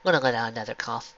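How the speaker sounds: plain reading voice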